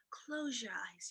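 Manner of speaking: soft, calm therapist voice; soothing hush. A narrator's reading. Therapist soothing the girl, soft and calm. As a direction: lull her gently. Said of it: "Breathe deeply and become one".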